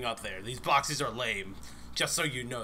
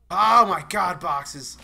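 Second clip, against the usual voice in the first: loud, high cry of exasperation; exasperated outburst; 6 semitones higher, 13 dB louder, much slower than usual. Real, not acted. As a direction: burst out loudly at the game. Startled by falling boxes in game.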